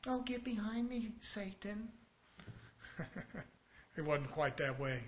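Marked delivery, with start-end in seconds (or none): 0.1-1.9 soft, mocking, effeminate Jesus voice; sissified movie Jesus. Preacher mocking the movie Jesus to the church, soft sissy voice. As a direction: mince it like a limp Hollywood Jesus, much softer. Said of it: "they got some little sissified Jesus".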